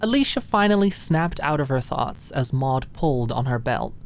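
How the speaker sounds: usual speaking voice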